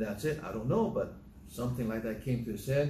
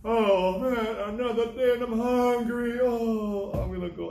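much higher and louder, a whining hungry lion; 11 semitones higher, 7 dB louder than usual. Acted. Preacher voices a hungry lion giving up the hunt, high, loud and whining. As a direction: play the disappointed lion, high, loud and put-on. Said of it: "And the lion just says".

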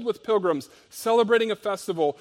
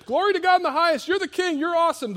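much higher, fast, excited praise from the crowd; cheering crowd; 12 semitones higher, 4 dB louder, faster than usual. Acted. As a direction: hail him like a jubilant festival crowd. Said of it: "So they're saying".

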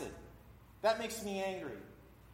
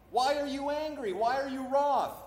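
high, raised scripture questioning; God questioning Cain; 5 semitones higher, 5 dB louder than usual. Acted. God confronts an angry Cain, high emphatic questioning. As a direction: put the questions higher and louder, as God. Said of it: "the Lord said unto Cain".

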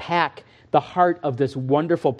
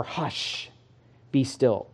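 hushed calming; 5 semitones lower, 6 dB quieter, much slower than usual. Acted. Jesus calming the storm quoted.

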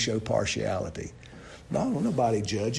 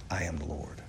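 much lower and softer, almost a hush; hushed solemn; 10 semitones lower, 12 dB quieter than usual. Shown as a demonstration. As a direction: drop the voice low and quiet on I am the Lord. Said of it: "two things about that statement".

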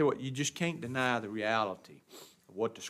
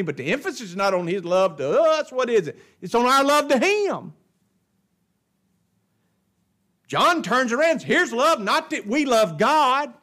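raised, emphatic pulpit voice; full-force preaching; 10 semitones higher, 10 dB louder than usual. Real, not acted. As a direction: preach it hard to the congregation.

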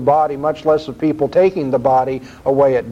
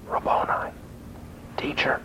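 hushed whisper; 12 dB quieter than usual. Acted. Preacher re-enacting Mary's first words to Jesus, whispered in awe. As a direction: breathe Rabboni as an awed, voiceless whisper.

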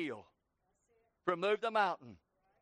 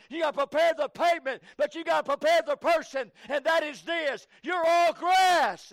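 driving revival sermon, building to a loud, high peak; fervent Southern pulpit delivery; full-force preaching; 3 semitones higher, 5 dB louder than usual. Real, not acted. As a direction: preach in hard rhythmic bursts.

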